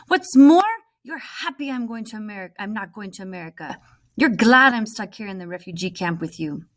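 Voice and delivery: loud, high bitter outburst; angry accusation. Acted. Omar shouting bitterly at his friend. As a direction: throw the accusation at her.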